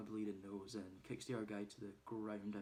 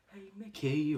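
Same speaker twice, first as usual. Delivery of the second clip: high, loud call across the yard; mock yell to the boss; 10 semitones higher, 12 dB louder than usual. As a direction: shout for Mickey to come. Calling Mickey out.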